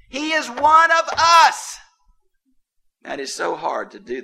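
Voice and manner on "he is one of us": higher, louder and slower, leaning on "us"; emphatic proclamation. Real, not acted. Preacher to congregation, a slow ringing climax. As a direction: proclaim it slowly to the back row. Climax of Jesus' humanity point.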